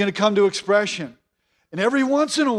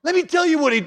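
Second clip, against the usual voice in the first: higher, hammed-up trash talk at a funeral; mock bitter eulogy; 5 semitones higher than usual. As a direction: play a petty preacher badmouthing the dead, mock. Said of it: "never got up there and said".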